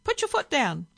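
loud, high, impatient bird; parrot voice. A narrator's reading. Parrot pushing driver to speed up, impatient squawk. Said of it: "said the parrot".

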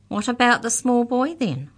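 high, loud challenge; demanding. A narrator's reading. Molly challenges Mallory about the boy, demanding. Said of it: "demanded Molly".

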